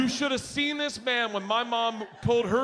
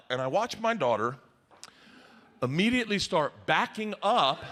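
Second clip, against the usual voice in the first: ordinary talk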